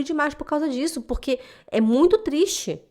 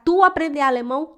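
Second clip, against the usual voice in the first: higher-pitched scoffing question; mocking disbelief; 5 semitones higher than usual. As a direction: imitate a boss ridiculing the very idea. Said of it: "Ele deu uma gargalhada". (incredulidade debochada, imitando o chefe)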